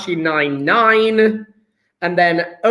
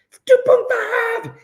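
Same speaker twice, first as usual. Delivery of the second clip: very high, playful squeal; squealed repeat. Pretend. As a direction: squeak the figure out in mock excitement. Repeats answer in mock excitement.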